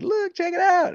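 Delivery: put-on high, loud squeal as a five-year-old; mimicked kid's shout, way up high; excited little boy. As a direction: show it off like an excited kid. Voicing himself at five years old.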